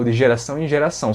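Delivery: ordinary delivery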